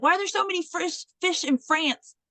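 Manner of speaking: high-pitched, quick exasperated question; mock frustration. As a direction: imitate a frustrated student complaining. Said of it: "she was getting frustrated".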